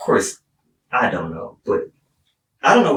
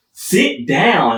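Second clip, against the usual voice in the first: firm order; 7 semitones higher, 4 dB louder than usual. Retold or imitated. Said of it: "they're all like".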